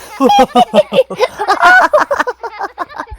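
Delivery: high acted giggling into laughter; gleeful group laugh. A narrator's reading. Pig family in the puddle, high gleeful laughter.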